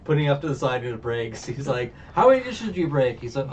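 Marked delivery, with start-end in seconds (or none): 2.1-3.2 high, loud, quick retold scolding; mock scolding. Boss confronting Luffy over broken dishes, mock scolding. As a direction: imitate an exasperated boss demanding an answer.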